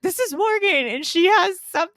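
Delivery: high, hammed-up introduction; gushing introducer. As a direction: present a friend with mock excitement. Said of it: "Like, you'd be like".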